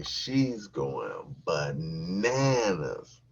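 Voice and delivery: ordinary delivery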